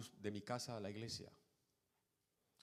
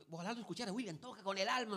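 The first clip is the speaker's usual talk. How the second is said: high-pitched, excited rave; imitated gushing enthusiasm; 11 semitones higher, 6 dB louder, faster than usual. Friend raving to William about a drummer, imitated enthusiasm. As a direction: gush like a thrilled fan.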